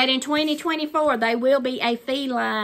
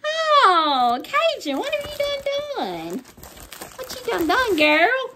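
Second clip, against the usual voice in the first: 2 high, squealing mock outrage at the gift; 3 playfully scold her friend in a high voice; 4 gift-giver teased with high mock outrage; 1 mock shocked scolding; 10 semitones higher than usual. Real, not acted.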